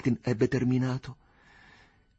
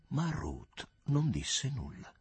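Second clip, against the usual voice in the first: plain reading voice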